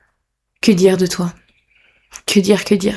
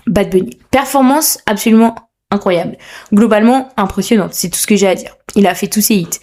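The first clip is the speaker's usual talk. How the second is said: ordinary talk, nothing marked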